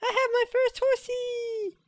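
very high, loud squeal of joy; jubilant cheer. Real, not acted. Player celebrating a first horsey, jubilant high squeal. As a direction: cheer with long held-out, squealed endings.

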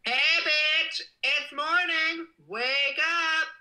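very high, loud wake-up shout; screamed alarm. Shown as a demonstration. Streamer demoing own alarm for y'all, screamed wake-up call. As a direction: yell it shrieky-high, stretching the words. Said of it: "I should make my own alarm".

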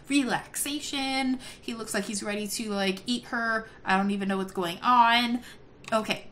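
normal talking voice

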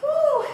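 high cheering woo; energetic coach whoop; excited whoop. Real, not acted. Coach cheering mid-workout.

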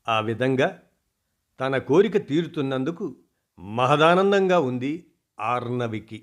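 plain reading voice

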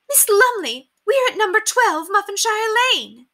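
high, loud astonished lady; high loud surprised exclamation; utter surprise. Acted. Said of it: "she exclaimed in a tone of utter surprise".

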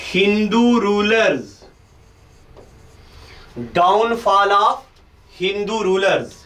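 slow, spaced-out teacher dictation. Acted. Teacher dictating notes slowly to camera. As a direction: read it out slowly so they can write.

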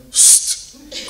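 one loud, unvoiced "sst"; sharp "psst" hiss. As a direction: imitate Dad's hissed wake-up signal. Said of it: "he would come back in again".

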